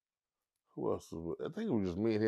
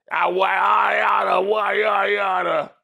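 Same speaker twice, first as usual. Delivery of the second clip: higher, louder mock yelling gibberish; mock ranting shout; 8 semitones higher, 7 dB louder than usual. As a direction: rant like a cartoon hothead. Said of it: "That's what the fuck he looked like".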